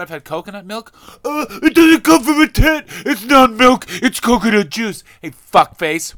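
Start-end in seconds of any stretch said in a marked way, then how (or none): 1.2-2.8 high, loud sneering impression of a critic; whiny high mockery, much louder; mock snob voice. Mocking a coconut milk critic. 3.1-5.0 high, loud sneering impression of a critic; whiny high mockery, much louder; mock snob voice. Mocking a coconut milk critic.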